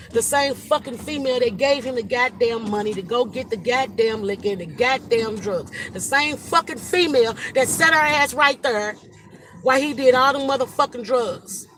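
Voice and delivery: raging at the woman who supplied the drugs; furious rant. Real, not acted. Grieving brother venting to livestream viewers, furious loud rant.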